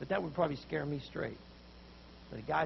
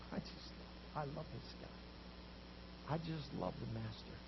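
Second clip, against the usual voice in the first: soft, slow, almost whispered; a softer, slower heartfelt aside; tender devotion; 4 semitones lower, 11 dB quieter, slower than usual. Real, not acted. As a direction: confide your love quietly.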